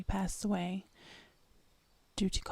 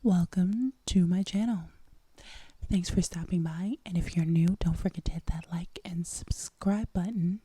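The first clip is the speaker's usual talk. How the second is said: ASMR whisper; 6 dB louder than usual. Real, not acted. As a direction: whisper close and intimate.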